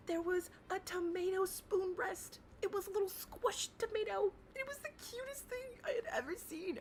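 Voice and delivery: squeaky cute-voice gush; gushing over cuteness. Real, not acted. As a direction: coo like it's adorable.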